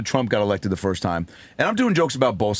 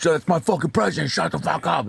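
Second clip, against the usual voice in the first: higher-pitched mock angry old man; irate old heckler; 7 semitones higher than usual. Old man defending his president to the comic, mock outrage. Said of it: "and the guy goes".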